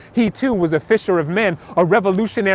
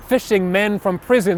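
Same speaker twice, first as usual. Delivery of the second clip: usual speaking voice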